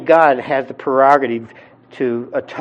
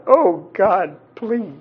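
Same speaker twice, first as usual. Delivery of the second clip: imitated pleading; 3 semitones higher than usual. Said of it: "crying out to God".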